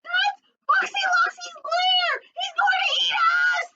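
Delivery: very high, loud panicked shrieking; panicked wail. Acted. Bird warning friends about the fox's lair, panicked shriek. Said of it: "Looney Little wailed".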